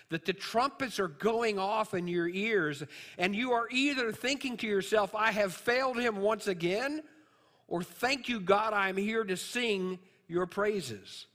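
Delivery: loud, high, ringing sermon delivery; full-force preaching. Acted. Preacher rousing the congregation about roosters crowing, loud and high. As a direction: proclaim it like a trumpet call.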